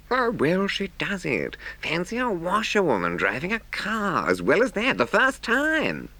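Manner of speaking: high, marvelling gentleman; admiring gentleman. Acted. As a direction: exclaim in high-pitched delighted wonder. Applauding gentlemen in the story.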